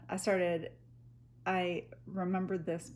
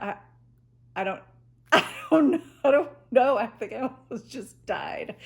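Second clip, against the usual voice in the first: panicked; 5 semitones higher, 11 dB louder, faster than usual. Retold or imitated. Panicked quote after nearly sinking.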